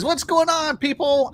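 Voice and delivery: high, drawn-out greeting; half-attempted impression, pitched well up; imitating a viewer. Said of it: "I can't say it in your voice".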